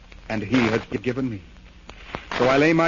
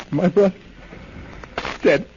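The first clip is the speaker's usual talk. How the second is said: anguished grief; 7 semitones higher than usual. Acted. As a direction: break out over the dead brother. Brother has just died.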